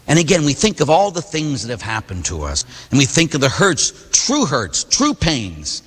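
ordinary delivery

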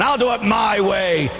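slow, high defiant declaration; mock stubborn sinner. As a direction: insist on your own way.

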